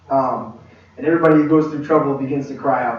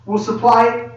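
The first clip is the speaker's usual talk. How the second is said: ordinary delivery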